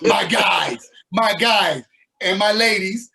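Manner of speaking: put-on loud New York accent greeting. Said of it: "I can't do that New York accent".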